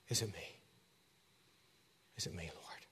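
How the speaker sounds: very soft, trembling-close question; hushed prayer. Acted. Believer asking the Lord, soft hushed prayer. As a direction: barely breathe it to God. Whispered prayer of self-examination.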